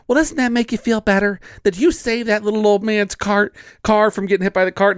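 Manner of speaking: high, lightly voiced wife; imitating wife. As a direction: tease it like his wife would. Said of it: "She's like".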